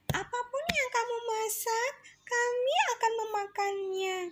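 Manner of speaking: high, light wife voice; wife's voice. Acted. Wife telling Hari they'll eat anything, high voice. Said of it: "katanya sambil membawa panci".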